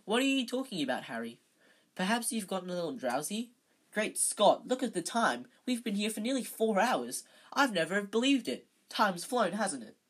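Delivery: high, lively exclaiming voice; astonished chatter. A narrator's reading. Lockhart amazed at the time, astonished chatter.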